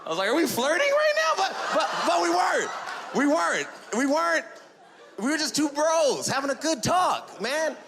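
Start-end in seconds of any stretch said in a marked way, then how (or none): 0.3-1.3 very high, excited squealed question; giddy squeal. Retold or imitated. Driver to officer, are we flirting, in a giddy high squeal. 1.4-7.8 high-pitched, insistent comic denial; mock protest. Comedian insisting they were just two bros, high mock protest.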